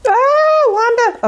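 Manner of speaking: sudden high-pitched squeal. Real, not acted. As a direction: squeal in surprise. Ink mishap squeal.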